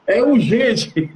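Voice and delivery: drawn-out, slow imitation; mock urgent message. Resident sends the manager an audio message, mock urgent. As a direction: stretch it out, imitating the sender.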